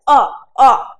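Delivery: अ voiced as a loud rounded 'aw'; borrowed-vowel sound. Shown as a demonstration. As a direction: demonstrate अ as an open 'aw', twice. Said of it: "इसका उच्चारण क्या होता है".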